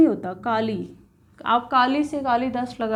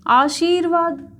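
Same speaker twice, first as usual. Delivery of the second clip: slow, drawn-out Aashirvaad, long shee; louder, higher, stretching each syllable of Aashirvaad; sounded-out word; 4 semitones higher, 4 dB louder, slower than usual. Shown as a demonstration. Teacher presenting the next word, Aashirvaad slowly sounded out.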